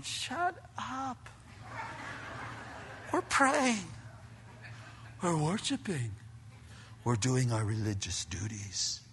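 very soft, stern reproach; hushed scolding. Acted. Worshipers interrupted at prayer, quietly scolding the noisy man. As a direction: shush the intruder under your breath. Acted hushing by offended worshipers.

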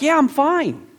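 high-pitched mock reassurance; acted protest. Man telling onlookers he is okay, high-pitched protest.

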